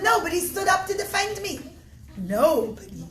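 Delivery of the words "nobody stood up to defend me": angry cry. Acted. Humiliated guest turns angry.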